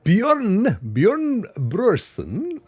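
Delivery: high, drawn-out comic hail; hammed-up singsong greeting by name; playful mock name-call. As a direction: stretch the name out, high and hammy. Hails viewer with hammed-up name.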